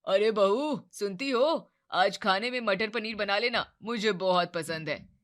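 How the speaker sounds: calling out loudly. Acted. Father-in-law calls loudly to daughter-in-law, loud call. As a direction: call out to her from the other room. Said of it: "जोर से आवाज लगाई".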